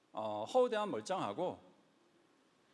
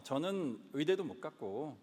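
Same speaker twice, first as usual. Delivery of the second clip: usual speaking voice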